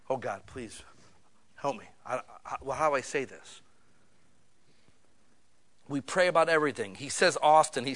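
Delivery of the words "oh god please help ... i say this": soft, halting plea to God; quiet flustered prayer. Retold or imitated. Nehemiah, put on the spot by the king, prays in a soft, halting voice. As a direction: stammer it out under your breath.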